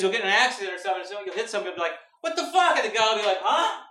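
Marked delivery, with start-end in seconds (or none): 2.2-2.8 high-pitched road-rage protest, acted; mock outrage. Driver after being hit, high indignant outburst. 3.4-3.8 high, whiny mock complaint; woken-up passenger. Passenger asleep in the back seat, high put-out protest.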